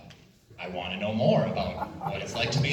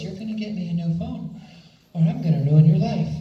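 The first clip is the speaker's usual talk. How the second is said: high-pitched mock threat, louder at the end; angry customer's threat; 7 semitones higher, 6 dB louder than usual.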